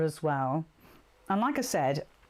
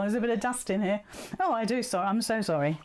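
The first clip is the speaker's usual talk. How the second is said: ordinary talk, nothing marked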